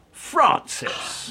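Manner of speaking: high, sharp call of "Francis!"; exasperated call. Acted. Calling her, exasperated.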